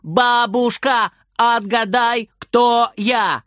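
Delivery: put-on high drawn-out kid's call; excited child's shout, stretched out. A narrator's reading. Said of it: "кричит Фридер".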